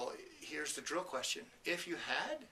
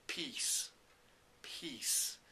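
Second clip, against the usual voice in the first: breathy whispered word, full of feeling; emotional whisper. Retold or imitated. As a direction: whisper it like a long-held wish. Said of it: "with a great deal of emotion, quietly into the microphone".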